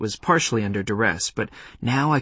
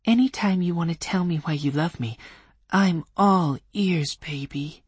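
syrupy sweet; 7 semitones higher than usual. Acted. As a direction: lay the sweetness on thick. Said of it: "her voice turning syrupy".

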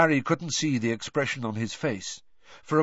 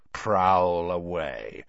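menacing; 4 semitones lower, much slower than usual. A narrator's reading. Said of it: "but his voice was full of menace".

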